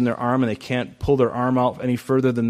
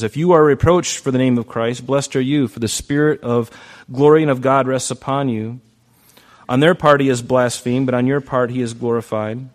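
louder declaimed Bible reading; loud scripture reading; 8 dB louder than usual. Acted. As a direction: proclaim the verse to the church. Reading scripture aloud loudly.

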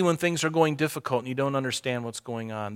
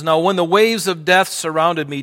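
loud, raised reading of the psalm; forceful scripture reading; 4 semitones higher, 8 dB louder than usual. Acted. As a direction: proclaim the verse to the congregation. Preacher reads scripture aloud.

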